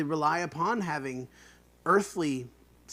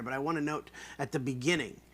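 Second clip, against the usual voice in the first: usual speaking voice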